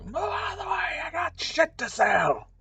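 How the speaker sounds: very high, strained holler; high-pitched character yell; pushy vendor shouting. Acted. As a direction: screech it like a hurried street seller. Acted vendor pushing through to sell.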